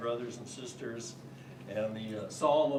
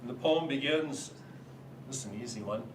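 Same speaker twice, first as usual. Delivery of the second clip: ordinary delivery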